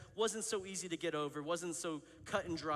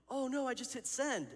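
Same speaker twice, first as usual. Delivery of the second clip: higher-pitched, panicked "oh no"; mock alarm; 8 semitones higher than usual. Friend confessing they just hit send, mock alarm. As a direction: act out a sudden horrified slip. Friend feigns horror at sending.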